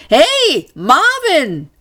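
high, loud call of surprise; astonished greeting. A narrator's reading. Man spinning round to find his robot, astonished. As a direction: call out in amazement. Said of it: "wirbelte erstaunt herum".

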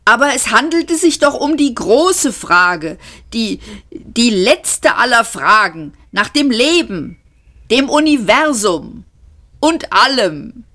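high, loud, plaintive wail; whining. A narrator's reading. Lun Kual whines at the computer about the great question. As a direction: complain like a cheated child. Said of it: "jammerte Lun Kual".

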